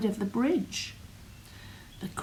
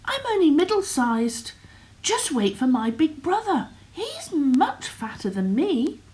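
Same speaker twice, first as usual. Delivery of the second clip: higher goat voice, louder; middle goat voice; 7 semitones higher, 4 dB louder than usual. Acted. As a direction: talk up to the troll. Middle goat talks to troll.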